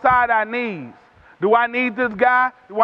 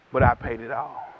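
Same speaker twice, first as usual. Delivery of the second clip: much lower and softer, solemn and steady; quiet pleading advocate; 13 semitones lower, 6 dB quieter than usual. Acted. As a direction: say it low like a final verdict of love. Advocate pleading before God.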